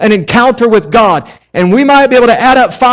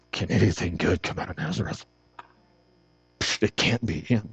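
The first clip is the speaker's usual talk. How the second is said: much softer, lower doubting mutter; skeptical scoff; 18 dB quieter than usual. Retold or imitated. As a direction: brush it off under your breath. Doubting Nathanael voiced in story.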